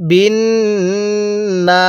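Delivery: বিন with a long nasal hum on the n, then না; ghunnah demo. Shown as a demonstration. Teacher shows ghunnah on the nun, বিন hummed long before না. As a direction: hold the n of বিন through the nose before না. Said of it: "এই নুনের মধ্যে আমি গুন্নাহ করছি".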